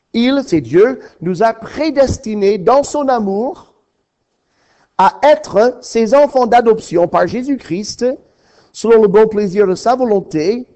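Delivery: fervent preaching. Acted. Preacher proclaiming Ephesians to his congregation, fervent. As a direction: proclaim it to the whole church.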